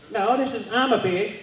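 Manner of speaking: put-on high, slow little old lady; a frail old lady insisting. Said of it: "this little old lady answered".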